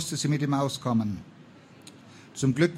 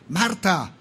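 higher, louder searching call; raised call for a friend; 5 semitones higher, 5 dB louder than usual. A narrator's reading. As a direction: call her name into the distance. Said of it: "rief sie erneut".

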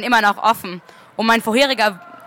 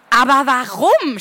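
cried out; 3 semitones higher, 3 dB louder, slower than usual. A narrator's reading. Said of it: "schrie ich".

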